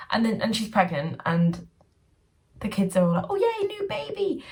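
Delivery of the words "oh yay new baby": much higher, sing-song fake delight; high-pitched sarcastic kiddie cheer; squealing mock cheer. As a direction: squeal it like overjoyed kids. Mocking kids' cheer.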